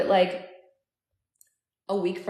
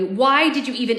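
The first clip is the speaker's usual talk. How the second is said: raised, louder accusing question; angry demand; 5 semitones higher, 5 dB louder than usual. A narrator's reading. Narrator challenging his dying future self, angry. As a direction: demand it with rising anger. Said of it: "I asked angrily".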